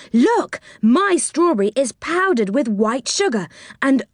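high-pitched relish with savouring oohs; delighted. A narrator's reading. Child delighted by sugared strawberries.

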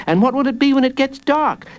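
put-on high, quick little boy; child's voice. A narrator's reading. Jacob quizzes the villain, high quick child voice. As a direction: pipe it up high and fast.

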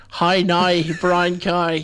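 Northern Irish accent turning "now" and "cow" into "nigh" and "kye". Shown as a demonstration. Host showing co-host the difference, Northern Irish accent like Derry Girls. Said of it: "if you say Northern Irish".

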